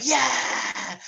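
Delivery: high mock cheer of a crowd. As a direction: cheer like a roaring audience. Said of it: "the Germans were like".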